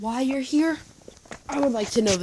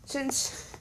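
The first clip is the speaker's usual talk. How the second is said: plain reading voice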